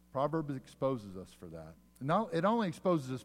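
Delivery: ordinary talk, nothing marked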